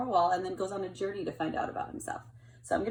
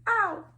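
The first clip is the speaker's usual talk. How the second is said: a yelp much higher and louder; 12 semitones higher, 6 dB louder than usual. Acted. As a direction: squeak out a little pained ow. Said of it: "he's saying".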